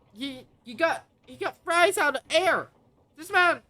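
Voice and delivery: loud, high-pitched hype; mock outrage. Real, not acted. Streamer riffing on a character out of chips, hyped exclamation. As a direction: exclaim it like a stunned fan.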